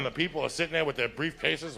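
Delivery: ordinary talk, nothing marked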